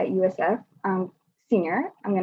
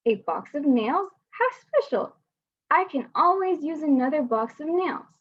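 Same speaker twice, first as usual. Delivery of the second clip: higher, hammed-up dad, squealing "How special!"; imitating dad; 7 semitones higher than usual. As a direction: overplay dad's delight at the nails. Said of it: "and every year he says".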